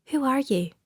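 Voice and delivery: a light hint of Dashneri accent, trying hard. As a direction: question asked in a careful put-on Dashneri accent. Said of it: "in her best Dashneri accent".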